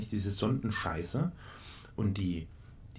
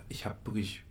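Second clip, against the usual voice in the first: whisper; 6 dB quieter than usual. Real, not acted. Whispered aside.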